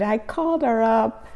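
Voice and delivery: ordinary talk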